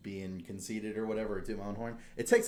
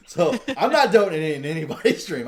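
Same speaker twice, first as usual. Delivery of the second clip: laughing; 8 semitones higher, 15 dB louder than usual. Real, not acted. Laughs while admitting being broke.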